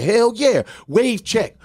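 imitating excited kids. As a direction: hype it up like teens showing off. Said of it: "They were like".

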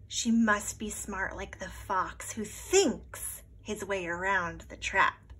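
ordinary delivery

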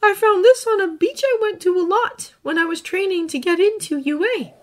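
put-on earnest boy, gently recounting; young hero voice. Izuku continues.

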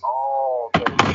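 ordinary delivery

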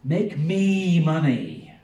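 preacher voicing a vain crowd-pleaser; boastful show-off. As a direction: ham up a vain celebrity begging for attention. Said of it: "it's always been about them".

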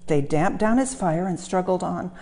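ordinary delivery, nothing marked

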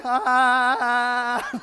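loud, high startled "Ah"; shocked gasp. Retold or imitated. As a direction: blurt it like the shock just hit. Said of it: "I was just like".

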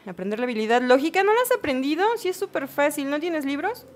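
high-pitched, disbelieving questions; incredulous questioning. Real, not acted. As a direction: question them as if it were obvious. Scolds game character in disbelief. (con incredulidad, voz aguda)

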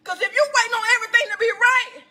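high, challenging pulpit cry; incredulous preaching. Acted. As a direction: fling the question at the congregation. Preaching at full force.

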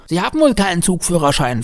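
put-on high, needling little-boy question; accusing child. A narrator's reading. Boy to train driver, high accusing child voice. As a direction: catch him out in a child's voice.

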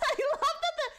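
laughing through the words; amused laughing. Real, not acted. Player amused by the winning headline, laughing. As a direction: giggle while recalling the funny line.

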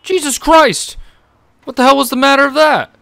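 high, loud yells after a jump scare; real fright spilling into outrage; startled shout. Real, not acted. Gamer scared by a sudden scripted attack, shouting high and loud.

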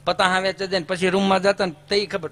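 ordinary talk

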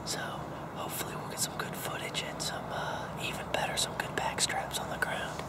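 hushed hunting-blind whisper. Real, not acted. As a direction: whisper so the deer can't hear. Hunter whispering in the deer stand.

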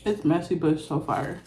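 speaking between sandwich bites; talking while chewing. Real, not acted. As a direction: mouth partly full of food. Seen 0: Holds bitten sandwich at mouth, jaw chewing between bites while talking.

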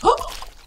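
sudden high gasp of shock; sharp gasp. Real, not acted. Gamer discovering the workbench is missing, sharp high gasp.

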